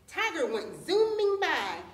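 "zooming" stretched out, high and loud; drawn-out emphasis. A narrator's reading. Narrator sends Tiger racing off, stretching "zooming" high and loud. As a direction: swoop up on "zooming" and draw it out.

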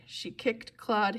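usual speaking voice

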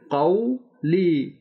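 قولی very slow, "qau-lee" with full "au"; clear waw glide. Shown as a demonstration. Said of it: "واضح کر کے پڑھنا ہے". (قولی واو کو واضح کر کے، کھینچ کر)

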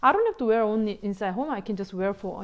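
ordinary delivery

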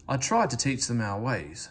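ordinary delivery

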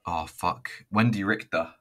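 ordinary delivery

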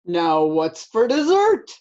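mildly voiced hungry bear; storybook bear. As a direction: ask it greedily as a big bear. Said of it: "said the bear".

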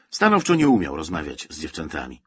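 ordinary delivery, nothing marked

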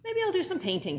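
high, lifted character voice for Bob; Bob's voice. Acted. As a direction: pitch up into a hopeful little Bob. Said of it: "said Bob".

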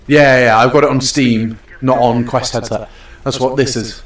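ordinary talk

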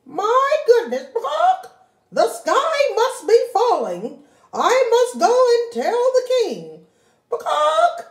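high, loud frantic hen; panicking hen. Acted. Henny Penny believing the sky falls, high frantic panic. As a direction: squawk the alarm.

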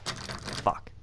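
breathless whisper while hiding; whispered curse. Real, not acted. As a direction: whisper so the monster won't hear. Hiding from monster.

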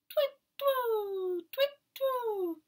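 very high, loud hooting twit twoo; the owl hooting in the garden, very high. A narrator's reading. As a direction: hoot like an owl. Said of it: "went the owl".

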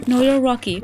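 ordinary talk

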